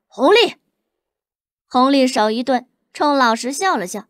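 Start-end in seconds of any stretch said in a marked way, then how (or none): 0.1-0.6 high, loud sharp call; angry shout of a name. A narrator's reading. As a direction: stop him with his name. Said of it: "老实火大道".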